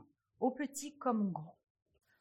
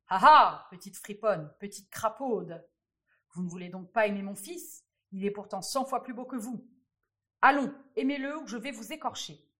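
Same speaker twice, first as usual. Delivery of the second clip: menacing king; 9 dB louder than usual. A narrator's reading. Wicked king threatens the princess.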